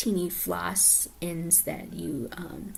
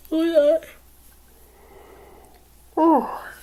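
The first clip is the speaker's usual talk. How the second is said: yawning; 10 semitones higher, 12 dB louder, slower than usual. Real, not acted. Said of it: "sorry about that. That was a big one".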